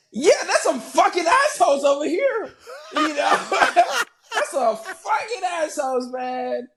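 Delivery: high-pitched, laughing exclamation. Real, not acted. Guest admitting some people are mean, laughing hard. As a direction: laugh through the words, voice way up.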